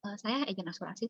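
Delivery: soft, hesitant mumble; barely audible, unsure answer; timid. Retold or imitated. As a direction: mutter it without daring. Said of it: "Nggak berani".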